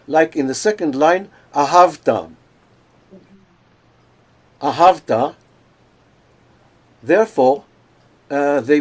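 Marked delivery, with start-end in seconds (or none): 1.5-5.4 ahavta with the weight on HAV; next-to-last syllable stressed. Shown as a demonstration. Teacher giving an example from the slide, ahavta stressed on HAV. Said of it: "emphasized on the next to the last".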